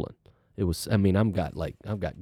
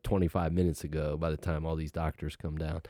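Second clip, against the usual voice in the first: usual speaking voice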